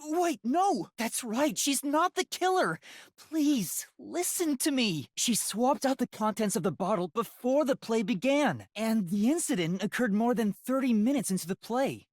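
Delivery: put-on a girl insisting someone is innocent; high girl voice, urgent. Voices girl defending Kurumi.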